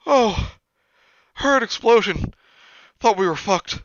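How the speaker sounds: high, loud broken-English voice; a groggy foreign friend explaining; lightly voiced half-asleep friend; imitating Millie. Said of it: "He just goes".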